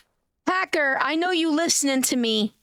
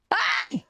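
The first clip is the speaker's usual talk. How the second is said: short, high startled cry; startled yelp; 10 semitones higher than usual. Real, not acted. Streamer hearing somebody nearby, startled yelp.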